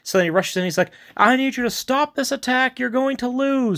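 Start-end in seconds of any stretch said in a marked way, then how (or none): 1.2-3.8 hammed-up urgent warning; mock urgent plea. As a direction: imitate a messenger begging them to stop. Retold urgent plea to halt attack.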